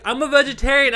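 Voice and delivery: high prim statement; prim high reply. Retold or imitated. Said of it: "And she's like".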